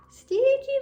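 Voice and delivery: hammed-up disgust. Teacher playing disgust at diaper smell for kids, squealed. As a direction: squeal it like a kid at a bad smell. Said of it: "reacts to the diaper smell".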